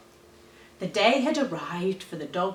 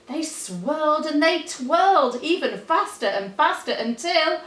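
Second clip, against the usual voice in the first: mounting excitement; 8 semitones higher, 4 dB louder than usual. A narrator's reading. Story builds to the accident.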